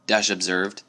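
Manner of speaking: plain reading voice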